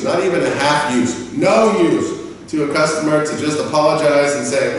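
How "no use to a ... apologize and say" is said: usual speaking voice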